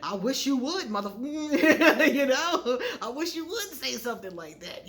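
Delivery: laughing loudly and high as she says it; a wife who'd be thrilled, laughing through the words; loud, giggly, overjoyed; laughing delight. Retold or imitated. Imagining the wife's delighted reply.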